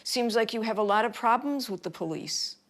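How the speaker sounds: high, condescending mock politeness; cocky. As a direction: imitate an official baiting a complainer. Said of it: "didn't like the tone".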